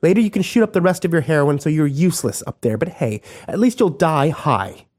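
high, biting sarcasm; mock him coldly; scornful female voice; sarcastic contempt. A narrator's reading.

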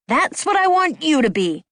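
high-pitched angry retort; angry shout. A narrator's reading. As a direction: shout back at him, volume held in. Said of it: "I shouted at him".

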